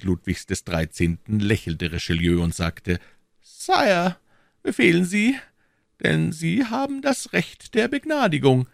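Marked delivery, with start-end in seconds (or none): 3.4-8.7 much higher, smooth cardinal voice; silky churchman stepping back, high voice; cardinal's voice. A narrator's reading. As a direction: play the sly cardinal, pitched well up.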